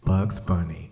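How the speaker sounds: ordinary talk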